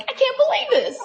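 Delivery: high, squealing delight; happy teen disbelief; overjoyed disbelief. Retold or imitated. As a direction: gush like a thrilled teen. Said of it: "back to the daughter".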